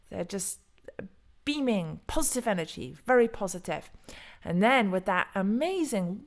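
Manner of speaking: ordinary talk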